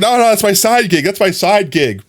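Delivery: high-pitched insistent denial; playful protest. Retold or imitated. Acted protest at being called out.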